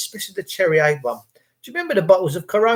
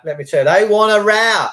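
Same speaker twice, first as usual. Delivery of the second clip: ordinary talk, nothing marked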